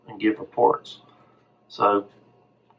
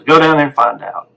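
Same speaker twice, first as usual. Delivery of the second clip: louder, higher curt command from the radiologist; gruff doctor order; 4 semitones higher, 6 dB louder than usual. Retold or imitated. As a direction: bark it like an impatient senior doctor.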